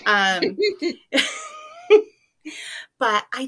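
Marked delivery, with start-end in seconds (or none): 0.1-2.2 words through laughter; laughing. Real, not acted. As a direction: laugh while talking. Laughing at wig image.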